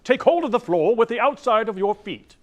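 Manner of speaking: loud, high, overblown theatrical command; grand old acting teacher. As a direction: boom it out like a stagey old teacher. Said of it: "one of Mister Alexander's acting teachers told him".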